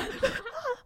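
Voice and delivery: high, soft laugh that rises; high rising laugh. Real, not acted. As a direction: laugh at your own awkwardness. Laughs after thumbs-up punchline.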